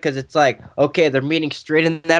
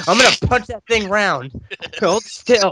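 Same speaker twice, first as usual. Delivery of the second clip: higher, louder mock brawler; Joe's voice; 6 semitones higher, 3 dB louder than usual. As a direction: boss him to hold still, loud. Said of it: "He's like".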